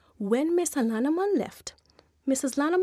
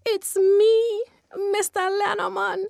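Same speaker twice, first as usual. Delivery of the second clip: nervous high answer; 7 semitones higher than usual. Acted. Lenneman answers the unseen voice.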